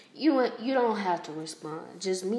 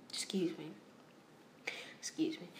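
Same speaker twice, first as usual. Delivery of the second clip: soft whispered apology; quick hushed excuse me; whispered aside; 2 semitones lower, 11 dB quieter than usual. Real, not acted. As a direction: whisper it off-mic. Quick aside, clearing throat.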